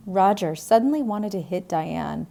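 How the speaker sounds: plain reading voice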